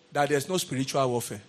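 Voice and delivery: ordinary talk, nothing marked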